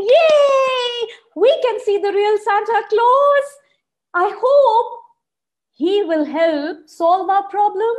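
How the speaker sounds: high, loud happy cheer. Acted. Dino babies cheering at the sight of Santa, excited. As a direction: cheer like a crowd of excited little kids. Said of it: "everybody started cheering".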